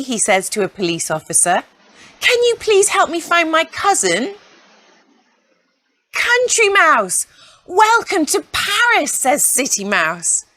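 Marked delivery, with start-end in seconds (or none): 2.2-4.3 high, small pleading mouse voice; a country mouse politely asking for help; lost little mouse. Acted. Country Mouse asking a police officer to find his cousin, high timid plea. 6.1-9.1 delighted welcome. Acted. City Mouse welcoming his cousin to Paris, very high delighted welcome.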